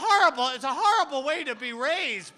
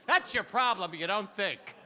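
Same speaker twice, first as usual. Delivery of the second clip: normal talking voice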